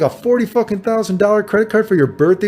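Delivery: mock-astonished at the credit card; stunned disbelief. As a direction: squeak out the shock. Retold disbelief at rich friend.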